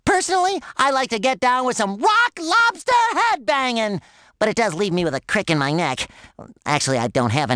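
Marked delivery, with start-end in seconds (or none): 2.0-4.0 rocking out. Acted. Hyped about headbanging.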